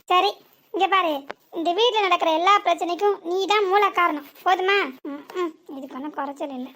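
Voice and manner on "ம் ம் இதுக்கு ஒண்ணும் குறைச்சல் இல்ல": lower, much softer grumble under the breath; muttered aside. Acted. Daughter-in-law grumbles aside after conceding blame, muttered. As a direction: mutter it to yourself, sarcastic.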